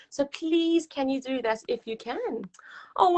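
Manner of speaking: usual speaking voice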